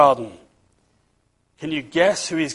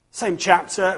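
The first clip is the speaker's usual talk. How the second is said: usual speaking voice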